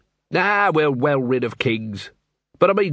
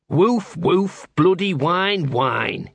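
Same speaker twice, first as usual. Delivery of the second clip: a higher, grumbling dog voice; scruffy talking dog; 4 semitones higher, much slower than usual. Acted. Gaspode the dog speaks.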